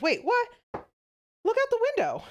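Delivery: a mock-startled woman pointing out the window; surprised woman. The woman urging the man to look out the plane window, startled. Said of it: "the woman turns to the man and she says".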